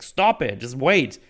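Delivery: mildly exasperated mock outburst; heated interruption. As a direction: imitate someone cutting in, pitch jumping up. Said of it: "heated mode and just talking over each other".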